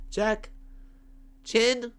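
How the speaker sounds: high, loud call-out; shouted. Real, not acted. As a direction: shout the word like a checklist. Checklist shouted.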